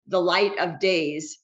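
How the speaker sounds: ordinary delivery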